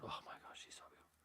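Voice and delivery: soft unvoiced whisper of admiration; awed whisper. Gazing at the pretty girl, an awed whisper. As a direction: breathe it out, starstruck and hushed.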